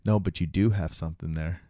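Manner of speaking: low, hushed deadpan; retold quiet insistence. Acting out coworker holding eye contact, low quiet insistence. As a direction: imitate someone insisting softly, pitch dropped.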